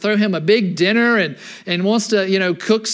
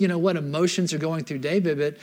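usual speaking voice

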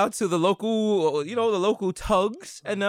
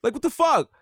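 high mock yell; 9 semitones higher than usual. Yelling at the couple in front to go to the register, high mock yell. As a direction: bark an order pitched up high. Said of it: "I had to yell at the couple".

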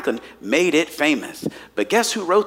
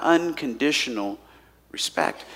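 hushed emphasis; 4 semitones lower, 6 dB quieter than usual. Shown as a demonstration. Key phrase.

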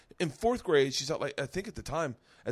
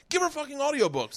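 high-pitched insistence; retold exasperated demand; 11 semitones higher, 4 dB louder than usual. Parent pressing the aides for audiobooks, exasperated. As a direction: hammer home the obvious fix.

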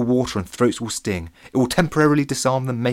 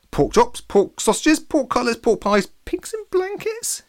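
high-pitched list, climbing ever higher; eager listing; 14 semitones higher, slower than usual. A narrator's reading. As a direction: chip in eagerly, pitch rising to the last item.

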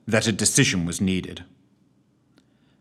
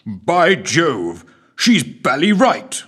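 put-on old English lord; 6 semitones higher, 6 dB louder, much slower than usual. A narrator's reading.

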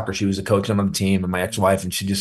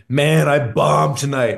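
higher, drawn-out mock lament; imitating dejected son; 5 semitones higher, slower than usual. Son calls his comedian dad after a bad show, imitated lament. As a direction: imitate a son's slow, higher-pitched complaint. Voicing son's call after bombing.

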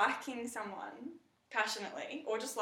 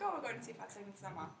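brisk and understated, softer than usual; casual matter-of-fact tone; 6 dB quieter, faster than usual. Retold or imitated. Answering a waitress who asked their plans, casual and matter-of-fact. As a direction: quote yourself casually, rattled off. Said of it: "I said it in that tone".

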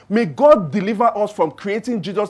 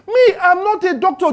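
mock modest denial; 8 semitones higher than usual. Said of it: "Susha says".

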